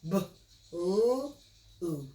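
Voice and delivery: blue split into B, ooh, ooh; blue broken into its separate sounds; sounding out phonemes. Shown as a demonstration. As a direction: tap out each sound of blue. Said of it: "One, two, three, three phonemes".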